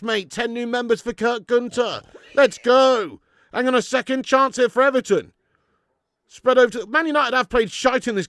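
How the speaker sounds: high, loud buzzing shout of thanks; excited. Real, not acted. Streamer celebrates a big donation, excited loud shout.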